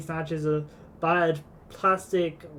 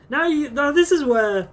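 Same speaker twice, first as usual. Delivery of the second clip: excited; 11 semitones higher, 9 dB louder than usual. Real, not acted. Sudden exclaimed realization.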